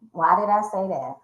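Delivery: normal talking voice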